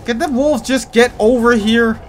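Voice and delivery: loud, high frantic yelling; yelling at the useless companion; panicked shouting. Real, not acted. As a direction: shout for help in real panic. Gamer panicking, calling for wolves.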